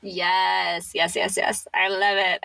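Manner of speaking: usual speaking voice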